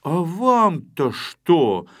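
slow, irritated retort; angry retort. Acted. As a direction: bite back irritably. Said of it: "рассердился".

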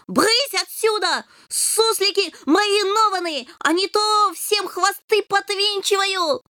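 high, furious little cat; indignant kitten. A narrator's reading. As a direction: scold them with proud outrage. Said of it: "вспыхнув от негодования".

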